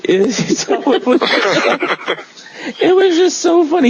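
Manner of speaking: high, helpless laughing through the words; real laughter. Real, not acted. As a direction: struggle to speak while cracking up. Cracking up at own imitation.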